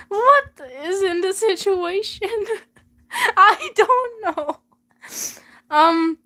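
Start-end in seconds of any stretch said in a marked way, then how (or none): 2.3-4.6 slow, high laughing words. Real, not acted. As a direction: laugh through it helplessly. Laughing at confusing plot.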